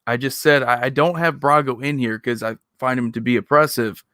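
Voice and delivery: ordinary delivery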